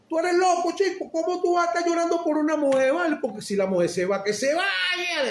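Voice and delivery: imitating his old harsh self; mock scornful yell. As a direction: scoff at the crying man. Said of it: "decía yo".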